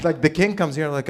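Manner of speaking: normal talking voice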